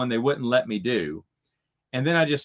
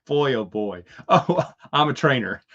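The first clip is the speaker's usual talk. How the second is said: normal talking voice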